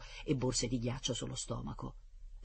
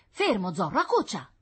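commanding shout at dog; 7 semitones higher, 7 dB louder than usual. Acted. Boy orders dog to stop.

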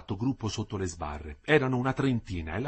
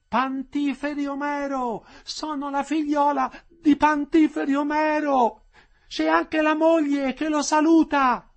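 high, slow call growing louder; daughter calling out; 6 dB louder, slower than usual. A narrator's reading. Daughter greeting her jailed father, calling out. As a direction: draw the words out to carry.

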